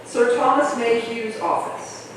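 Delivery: normal talking voice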